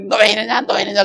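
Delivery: acted-out parent on the child's case; parent nagging.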